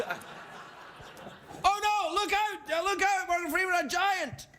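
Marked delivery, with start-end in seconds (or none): 1.6-4.5 high, hammy puppet panic; mock alarm. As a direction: cry out a silly warning. Puppet warning of a giant.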